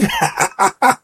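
high, amused real laugh. Real, not acted. Host amused by their own Trini accent, laughing. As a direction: chuckle at your own impression.

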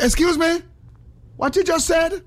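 indignant wife. Retold or imitated. Wife confronting the ad man.

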